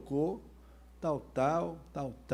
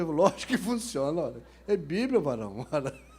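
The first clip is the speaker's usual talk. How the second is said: mock indignant assurance; 6 dB louder than usual. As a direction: insist loudly as if it were obvious, playfully. Acts out pastor's emphatic comeback.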